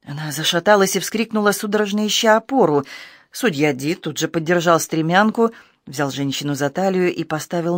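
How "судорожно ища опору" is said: usual speaking voice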